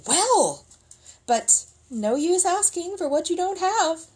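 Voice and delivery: high exclaim then mock regret; mock sighing soldier. Soldiers pretending resignation to the villagers, high. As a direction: sigh as if it's hopeless.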